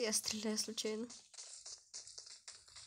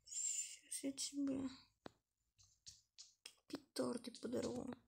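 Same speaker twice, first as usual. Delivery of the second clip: whispered threat; 10 dB quieter than usual. Acted. Threat to teammate.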